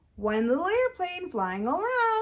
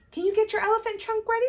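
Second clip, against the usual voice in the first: ordinary talk, nothing marked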